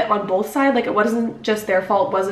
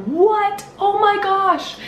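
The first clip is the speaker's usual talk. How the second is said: much higher mock gasp of disbelief; shocked fans; 11 semitones higher, much slower than usual. Fans reacting to her photo, high mock disbelief. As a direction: squeal it like a shocked fan. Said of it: "all of you guys being like".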